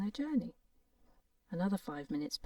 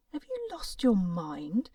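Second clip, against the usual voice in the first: slow, louder disbelieving question; shocked exclaim; 2 semitones higher, 6 dB louder, slower than usual. Acted. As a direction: ask as if he has gone mad. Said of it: "the other doctors exclaimed".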